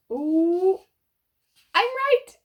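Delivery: gleeful, proud of the guess; excited reveal. Real, not acted. Revealing the page to children, thrilled to be right.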